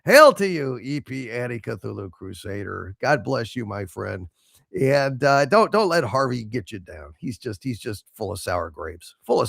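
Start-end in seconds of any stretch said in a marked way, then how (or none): none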